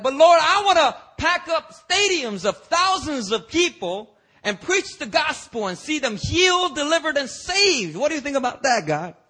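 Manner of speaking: high, loud, pleading believer voice; eager believer. Retold or imitated. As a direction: beg God like an overeager preacher-to-be. Preacher voices whiny eager believer.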